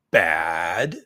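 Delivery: strained cry on the word bad; hammed-up pained cry; mock anguished cry.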